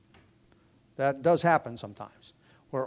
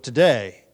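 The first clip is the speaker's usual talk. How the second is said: "Today" hit hard and louder, then a pause; stress on Today; 2 semitones lower, 7 dB louder than usual. Shown as a demonstration. Preacher quoting the psalm to his hearers, punching "Today". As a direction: lean on "Today" as the key word. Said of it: "with the emphasis on the first word".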